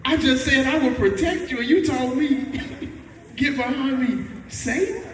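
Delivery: high, incredulous, half laughing; a stunned disciple who can't believe it; shocked disbelief. Retold or imitated. Disciple stunned by his teacher's rebuke, high incredulous voice. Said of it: "that'll be a little bit like".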